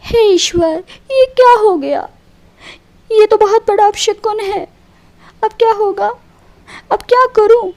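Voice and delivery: high, tearful wail; wife weeps over the burnt temple; crying in distress. Acted. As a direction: lament the burnt shrine, near tears. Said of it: "Shalini व्याकुल होकर रोने लगती है".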